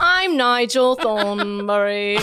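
put-on slow British Nigel Thornberry accent; cartoon British explorer accent, slow. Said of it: "That was kinda good".

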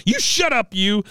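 high, loud retort in character; mock angry outburst. Recapper as struggling patient silencing her tormentor, mock angry outburst. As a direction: snap it back high and loud, imitating fury. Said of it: "She goes".

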